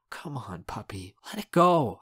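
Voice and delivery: weary plea that rises at the end; begging. A narrator's reading. Teen begs a friend to drop it, pleading. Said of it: "I beg".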